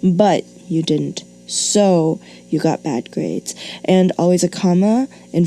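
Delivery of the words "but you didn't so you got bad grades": slow, deliberate read of the fixed sentence; calm corrected reading; slow, measured. Shown as a demonstration. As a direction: lay out each clause slowly and clearly. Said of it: "conjunctions and transition words like".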